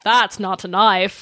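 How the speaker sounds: slow mock Australian accent; light mock Australian accent. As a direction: ham up a Crocodile Dundee Australian. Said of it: "I went".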